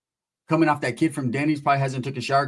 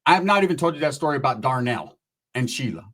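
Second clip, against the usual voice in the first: normal talking voice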